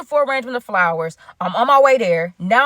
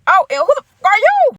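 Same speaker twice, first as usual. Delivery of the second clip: much higher, louder furious challenge; angry outburst; 11 semitones higher, 6 dB louder than usual. Retold or imitated. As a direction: snap at the stranger, outraged. Confronting the man at bar.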